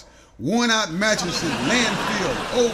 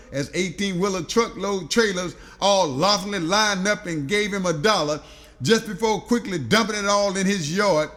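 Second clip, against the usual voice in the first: usual speaking voice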